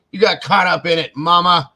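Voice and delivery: high, loud playful teasing; teasing a friend who got caught skipping work. Real, not acted. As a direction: rib her good-naturedly, up high and loud. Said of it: "I'm just teasing you".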